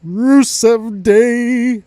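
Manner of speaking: high, very slow taunting sing-song; imitating a heel taunting his old partner; mocking sneer. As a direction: drag it out to rub it in. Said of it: "he goes".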